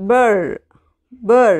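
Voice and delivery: ബർ said twice, short and punched; sounding out. Shown as a demonstration. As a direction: model ബർ for the class, crisp and firm. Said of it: "B plus R".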